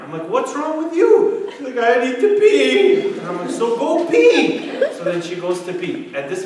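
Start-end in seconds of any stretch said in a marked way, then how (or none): 0.3-1.5 high, loud, drawn-out mock shout; exasperated yell. Dad confronts crying daughter at night, exasperated yell. 1.8-3.1 put-on high, loud, drawn-out child whine; crying little girl. Daughter wails to dad at night, mock crying. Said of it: "his sister's crying". 3.5-4.7 high, loud, slowly stretched mock yell; exasperated shout. Dad answers wailing daughter, exasperated shout.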